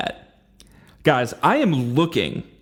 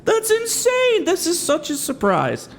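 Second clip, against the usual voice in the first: high, slow fake astonishment; mock surprise; slower than usual. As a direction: gasp it out in hammed-up shock. Feigns surprise at known news.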